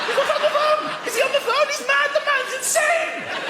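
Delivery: mock alarmed passengers. Passengers panicking about the swearing man, high squeak. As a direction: gossip in shock.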